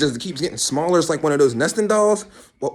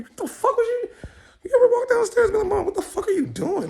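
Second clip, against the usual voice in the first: high, squeaky rant at mom; high-pitched mock outrage. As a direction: squeal it in comic disbelief. Acted child berating mom.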